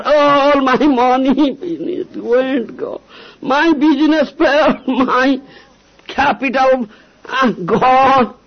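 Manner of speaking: high, wailing imitation of a man mourning lost money; a preacher mimicking a moaning businessman, mock wailing; mock lament. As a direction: ham up the grief over the lost capital. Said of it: "you are crying".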